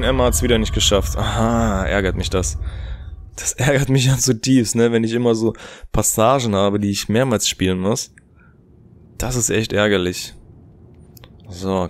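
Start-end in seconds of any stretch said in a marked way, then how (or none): none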